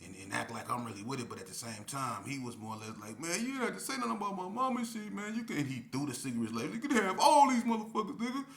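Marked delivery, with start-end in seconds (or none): 3.2-5.7 high, put-on tearful complaint; hurt about his mother; crying K P. K P to the narrator, imitated tearful high complaint. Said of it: "He started crying". 6.7-8.4 very high, very loud, fast tearful yell; hurt and furious at once; crying outburst. Retold or imitated. K P throwing cigarettes at the narrator, imitated tearful yell. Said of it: "He started crying".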